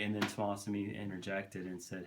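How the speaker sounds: usual speaking voice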